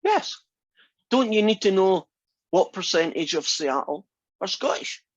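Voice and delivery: usual speaking voice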